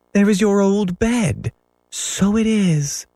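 put-on high young children's voices; child voices. A narrator's reading. Children back in the nursery, high child voices. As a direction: voice them as small kids.

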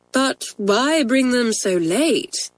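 slow, measured, serious questioning; serious headmistress. A narrator's reading. Head to twins about late essays, serious. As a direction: question gravely and without hurry. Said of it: "in her deep, serious voice".

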